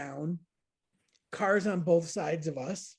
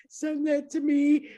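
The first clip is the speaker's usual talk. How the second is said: laughing plea; 9 semitones higher, 5 dB louder than usual. Retold or imitated. Asking friend for photo mid-chaos.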